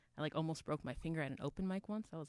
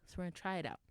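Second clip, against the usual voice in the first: ordinary talk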